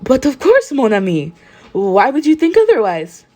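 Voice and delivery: put-on French accent. A narrator's reading. Aoyama answering a classmate, lightly French-accented. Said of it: "Are you really French".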